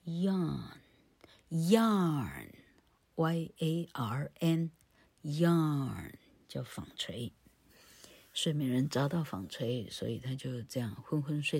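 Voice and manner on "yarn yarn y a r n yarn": yarn stretched very long, letters named one by one; slow, drawn-out yarn for learners; pronouncing and spelling. Shown as a demonstration. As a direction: spell out yarn slowly, lengthening the word. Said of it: "怎么拼呢".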